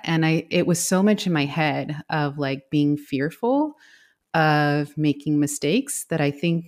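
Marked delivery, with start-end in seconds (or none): none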